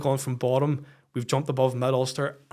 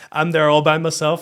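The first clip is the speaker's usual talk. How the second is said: usual speaking voice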